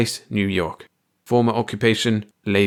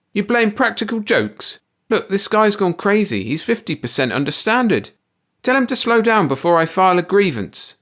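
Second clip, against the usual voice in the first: high, louder angry worker snapping; furious snapping; 11 semitones higher, 3 dB louder than usual. A narrator's reading. Furious worker snapping at his boss. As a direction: snap at the boss, sweating and furious. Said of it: "A furious, sweating Benny snapped".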